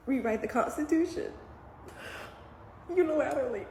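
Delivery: tearful, rising high at the end; fighting back tears. Real, not acted. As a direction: choke it out, holding back crying. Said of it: "I'm trying not to cry".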